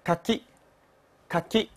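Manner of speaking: "かき" with a clean unvoiced k; voiceless k. Shown as a demonstration. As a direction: say "かき" crisply, no voicing on the k. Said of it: "这个读作".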